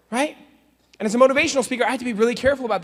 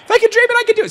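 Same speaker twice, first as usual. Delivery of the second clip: put-on much higher and louder, childlike cry; high kid voice; 7 dB louder, faster than usual. Kid jumping off the balcony, squealing high. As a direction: squeal it like a hopeful kid leaping.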